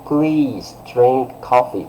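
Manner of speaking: slow courteous request; well-mannered, polite. Shown as a demonstration. Teacher translating the polite tone for students, well-mannered. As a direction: invite politely, drawing out the words. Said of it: "you are very well-mannered".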